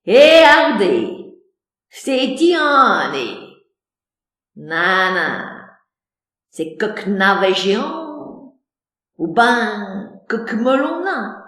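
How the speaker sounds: loud, slow peasant drawl; country yokel voice; rustic accent. Acted. (accent campagnard)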